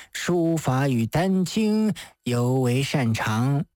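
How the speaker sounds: unhurried praise in a solemn priestly voice; venerable Taoist master. Acted. Master praising the late priest's talents, venerable and measured.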